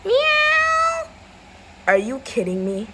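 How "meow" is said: very high, loud meow; long squealed cat cry. Acted. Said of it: "where this cat is".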